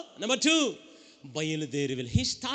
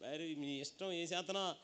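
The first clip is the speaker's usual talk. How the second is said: quiet threat; 4 semitones lower, 12 dB quieter than usual. Retold or imitated. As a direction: threaten them calmly and quietly. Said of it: "అని అనగానే".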